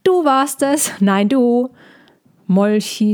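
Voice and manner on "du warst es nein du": high excited squabbling cries; children calling out. A narrator's reading. Otterkinder streiten sich auf Molchis Rücken, rufen hoch und aufgeregt. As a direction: otter kids bickering on the dragon's back, calling out high. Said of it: "und riefen".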